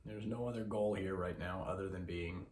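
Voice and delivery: soft, measured voice with long pauses; guided meditation. Acted. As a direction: guide listeners gently into breath awareness.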